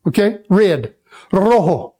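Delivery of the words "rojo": Rojo with a trilled first R; Rojo, said the Spanish way with rolled R. Shown as a demonstration. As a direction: roll that opening R of Rojo. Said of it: "That first R has to be rolled".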